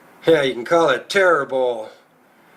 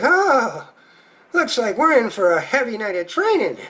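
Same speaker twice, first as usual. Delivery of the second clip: higher, put-on excited little lady; excited wife; 5 semitones higher than usual. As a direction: coo it like an eager wife. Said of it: "She's like".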